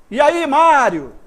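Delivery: loud, high, drawn-out hail; calling someone. Shown as a demonstration. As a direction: call out the name slowly and loudly. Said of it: "fazendo um chamamento de alguém". (chamando alguém em voz alta, arrastado)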